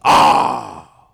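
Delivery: very low growled "Ah!"; demon's angry cry. Acted. Demon snarling at Jesus, a deep low "Ah!". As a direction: let out a deep growl of dismay. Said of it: "It's like, "Ha!" at Jesus".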